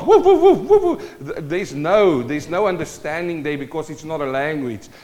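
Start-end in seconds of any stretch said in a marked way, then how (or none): 0.0-1.2 loud, very high mock woofs; rapid-fire high-pitched dog impression; imitated dog bark. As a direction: bark like an excited little dog. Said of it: "and dogs".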